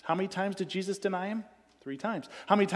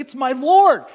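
big joyful cry of recognition; excited shout; 9 semitones higher, 15 dB louder than usual. Retold or imitated. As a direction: shout it out across the water. Peter shouting from the boat.

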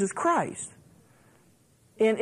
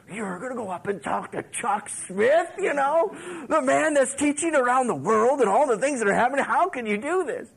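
high, loud retold disbelief; mock incredulous; 5 semitones higher, 4 dB louder than usual. Young man to his date about approaching the famous preacher, mock incredulous. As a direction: protest in exaggerated amazement.

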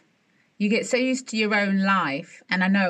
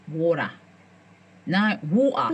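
uncertain New York try on WATER; attempted New York accent; 5 semitones lower than usual. Friends practising water, tentative New York accent. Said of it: "New York accents when you're saying water".

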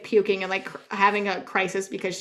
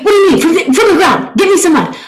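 much louder, higher, laughing-exasperated shout; mock outraged disbelief; screaming; 9 semitones higher, 15 dB louder than usual. Friend cannot find mud, loud exasperated shouting. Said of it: "I'm screaming so much".

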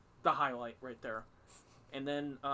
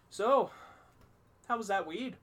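put-on German accent; 7 semitones higher, 5 dB louder than usual. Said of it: "You can hear [name]'s German accent with this".

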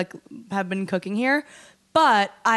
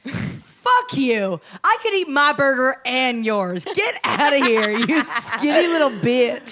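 loud, higher playful outburst; mock angry rant; 4 semitones higher, 3 dB louder, slower than usual. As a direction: rant at him, half laughing.

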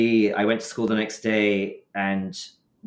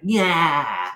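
cheeky yeah; 8 semitones higher, 5 dB louder than usual. Retold or imitated. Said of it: "stuck out my tongue really big and went".

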